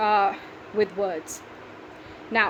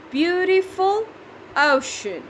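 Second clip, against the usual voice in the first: "beautiful" high and slow, pause, then "ocean" falling; syllable by syllable; 7 semitones higher, 3 dB louder, slower than usual. Shown as a demonstration. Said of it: "three syllables".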